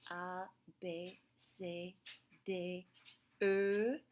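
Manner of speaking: French alphabet recited slowly, pausing between letters; French letter names. Shown as a demonstration. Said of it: "what the letter E says in French".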